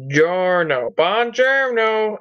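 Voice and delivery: mock Italian greeting. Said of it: "I said buongiorno".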